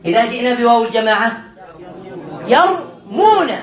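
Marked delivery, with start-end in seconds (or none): 2.5-3.5 يرمون very slow, split into two parts; teacher spelling out يرمون by ear in slow chunks; syllable by syllable. Shown as a demonstration. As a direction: stretch يرمون out, pausing after the first syllable. Teacher models the suffixed verb.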